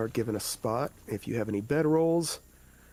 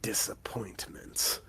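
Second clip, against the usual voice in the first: low, slow whispered hobgoblin voice; whispery hobgoblin; 4 semitones lower, 7 dB quieter, slower than usual. Acted. Hobgoblin warning the party about goblins, low whisper. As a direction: hush it like a sly creature.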